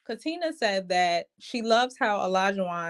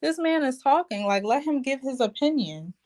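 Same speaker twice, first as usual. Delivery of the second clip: higher, hushing a rowdy friend; mock scolding Jasmina; 6 semitones higher than usual. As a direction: imitate her settling Lindsay down. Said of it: "was like".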